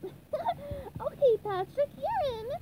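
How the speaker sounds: very high, grinning cartoon voice; happy, high-pitched welcome; SpongeBob. A narrator's reading. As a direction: say it through a grin, high up. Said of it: "Grinning, SpongeBob said".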